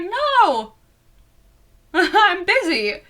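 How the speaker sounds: high-pitched, drawn-out playful refusal; mock refusal. Addie refuses to surrender her soul, mock high-pitched refusal. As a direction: turn him down in a high, silly voice. Acted refusal of Luke's plea.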